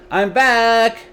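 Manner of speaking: loud, high call from outside. A narrator's reading. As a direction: call out to announce you're home. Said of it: "he called out".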